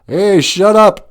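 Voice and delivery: loud high slow shut up; mock shout. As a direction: bark it at the critics for fun. Telling off the critics.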